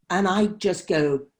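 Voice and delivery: usual speaking voice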